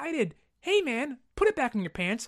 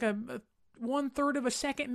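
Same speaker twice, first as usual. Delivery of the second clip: usual speaking voice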